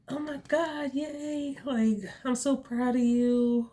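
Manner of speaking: imitating excited therapist. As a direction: gush over her like a proud therapist. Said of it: "and she's like".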